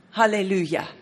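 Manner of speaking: ordinary talk, nothing marked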